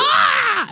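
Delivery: high, loud pained yell; distressed battle cry. Retold or imitated. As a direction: cry out like a hit game character. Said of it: "she always sounds like she's in distress".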